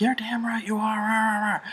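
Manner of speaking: high, strained imitation of an angry dad; mock angry screaming. Dad yells at child on the phone, high mock screaming. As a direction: rant in mock fury, trailing into nonsense. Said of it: "he was screaming at me".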